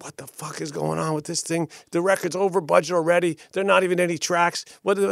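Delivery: high-pitched angry rant, imitating him; retold screaming. Musician banging my table over budget, retold screaming. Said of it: "and he's screaming".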